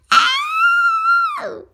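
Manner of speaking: very high loud yelp; shrill yelp. Acted. Child squealing at the mud shampoo idea, high yelp. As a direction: squeal out a sudden cry. Shrieked exclamation.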